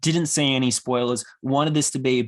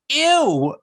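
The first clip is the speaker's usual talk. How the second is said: put-on much higher, louder childish squeal; loud kid's ew; 13 semitones higher, 5 dB louder than usual. A little kid in the cinema reacting to the kiss, loud squealing ew. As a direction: squeal it like a grossed-out five-year-old. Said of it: "really loudly".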